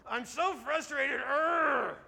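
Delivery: high, drawn-out frustrated cartoon voice; Daniel Tiger. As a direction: stretch the words, end in a loud growl. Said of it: "It's Daniel Tiger".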